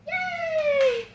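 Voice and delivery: very high, loud drawn-out squeal; sky-high gleeful cheer; excited cheer. Shown as a demonstration. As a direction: whoop to get the dog worked up. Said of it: "I'm doing exciting things".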